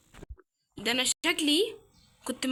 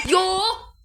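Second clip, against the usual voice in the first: startled yelp; 7 semitones higher, 7 dB louder, much slower than usual. Real, not acted. Startled by the witch.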